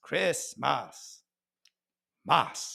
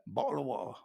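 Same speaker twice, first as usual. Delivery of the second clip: softer British "bo'le o' wa'er"; T-less British accent; 2 semitones lower, 9 dB quieter, much faster than usual. Retold or imitated. Comedian puzzling over other Brits, T-less British accent. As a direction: lightly mimic British with glottal stops for Ts. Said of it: "They just skip the tea altogether".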